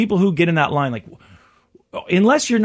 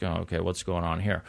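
curious murmur; 11 semitones lower, 12 dB quieter than usual. Retold or imitated. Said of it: "now I'm kinda looking up".

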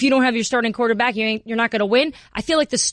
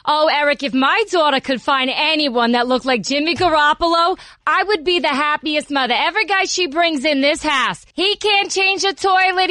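higher, brassy Jersey mom rant; New Jersey mom accent; 4 semitones higher than usual. Acted. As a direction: complain like a New Jersey Italian mother. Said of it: "This is exactly how she sounds".

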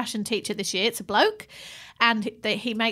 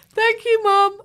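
put-on higher, louder kid's chirpy thank you; sing-song daughter thanks; 11 semitones higher, 7 dB louder, slower than usual. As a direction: play the grateful child brightly. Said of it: "And she was like".

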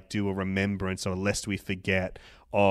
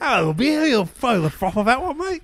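much higher, louder ocker Australian voice, consonants dropped; broad Australian accent; 7 dB louder than usual. Retold or imitated. Mocking the dirty dog Australian accent, laughing at it. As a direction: ham up a thick, grubby bush Australian. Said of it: "dirty dog Australian accent".

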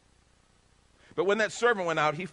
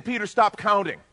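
firm command; 6 semitones higher, 6 dB louder than usual. Retold or imitated. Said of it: "He's saying".

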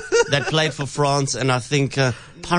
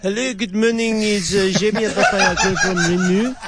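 hammed-up French accent, pitched higher; 5 semitones higher than usual. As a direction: play a mock French executive on the phone. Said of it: "Okay, Pepe Le Pew".

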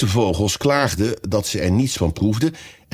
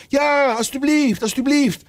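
high, drawn-out begging; chorus calling. A narrator's reading. The others begging the mouse to return, calling out. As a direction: beg slowly in a high crowd-like cry. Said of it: "de anderen riepen in koor".

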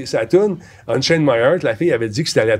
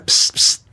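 imitated psst. Retelling [name] calling him.

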